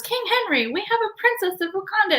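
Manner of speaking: high, quick announcement to a king; princess announcing. Retold or imitated. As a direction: imitate a princess presenting a guest.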